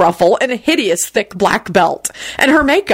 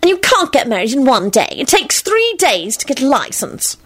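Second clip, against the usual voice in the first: high, plaintive protest; whiny pitched-up complaint; wailing; 7 semitones higher than usual. A narrator's reading. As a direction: wail the objection fretfully. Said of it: "Solange wailed".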